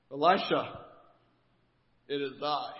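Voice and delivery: pompous commander. Retold or imitated. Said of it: "I imagine this scenario going down something like this".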